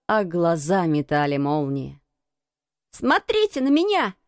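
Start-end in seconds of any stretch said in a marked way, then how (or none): 2.9-4.1 put-on high-pitched commanding boy; boy's high, defiant cry; cried out. A narrator's reading. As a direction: demand attention, pitch well up. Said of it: "крикнул он Бену Уизерстаффу".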